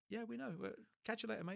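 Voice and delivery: lightly voiced cheeky builders; mocking. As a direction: tease him and walk off. Workmates mocking him.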